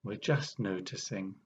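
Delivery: unhurried meditation guide, long pauses between phrases; guided meditation. Real, not acted. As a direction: ease listeners into awareness, phrase by phrase, leaving space.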